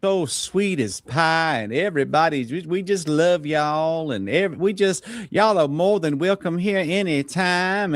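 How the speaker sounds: a hint of sugary Southern welcome; lightly drawled mock hospitality; mock Southern sweetness. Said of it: "everything was just sweet and lovey-dovey".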